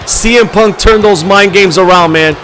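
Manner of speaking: loud, driving ringside commentary; hyped play-by-play. Acted. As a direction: call the match like an arena announcer. Live wrestling match commentary.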